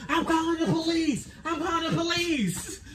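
high shouted neighbor threat, breaking into laughter; angry neighbor. Retold or imitated. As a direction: yell it like the furious neighbor. Neighbor threatening police, voiced.

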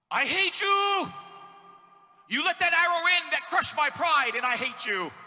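shouting his hatred at God in a sermon; furious shout at God. Acted. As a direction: rage up at heaven. Preacher acts raging at God.

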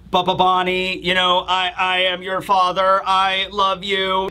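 halting, monotone broken robot; glitching robot. Retold or imitated. Broken robot dad reaching his daughter, halting monotone. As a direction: stammer it out on one level note, slowing at the end. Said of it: "like, the robot is glitching out or something".

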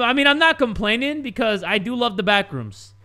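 ordinary delivery

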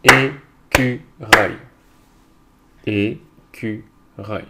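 Écureuil very slowly in three beats, é, cu, reuil; Écureuil chopped in three with long gaps; syllable by syllable. Shown as a demonstration. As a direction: beat out each syllable of Écureuil. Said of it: "on va frapper les syllabes".

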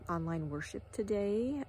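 normal talking voice

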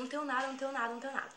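high, panicky repeated plea; desperate pleading. Retold or imitated. Girl telling robber she has nothing, desperate. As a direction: imitate yourself frantically begging. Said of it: "você fica tão desesperada".

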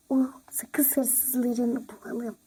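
hushed conspiratorial whisper. Acted. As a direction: lean in and whisper the plan. (fısıltıyla, gizlice)

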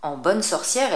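usual speaking voice

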